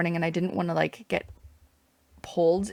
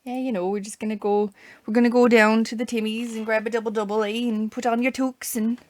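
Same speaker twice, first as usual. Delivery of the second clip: mock Canadian accent; 4 semitones higher, 5 dB louder than usual. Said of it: "force a Canadian accent".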